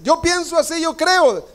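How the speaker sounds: high, smug mimicry of someone trusting their own opinion; mocking a self-sure believer. Preacher mocking a churchgoer who follows his own thinking, high and smug. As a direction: pitch it way up and sound self-satisfied.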